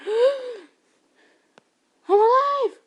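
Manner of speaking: very high, loud burst of relief; gasping relief. Acted. Rescued girl gasping out joy after drowning. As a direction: gasp it out after surfacing.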